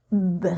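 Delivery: "br" said alone, with no vowel after it; isolated consonant cluster. Shown as a demonstration. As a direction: clip the "br" with no vowel at the end. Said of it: "je vais la prononcer b". ("br" prononcé seul, sans voyelle)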